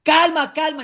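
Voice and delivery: loud, high "Calma"; sideline shout. Shown as a demonstration. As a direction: call it out hard to the pitch. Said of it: "con palabras claves".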